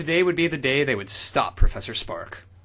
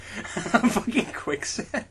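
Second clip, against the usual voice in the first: laughing; 7 semitones higher, 3 dB quieter, much slower than usual. Real, not acted. Laughing at the plot.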